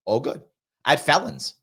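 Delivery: usual speaking voice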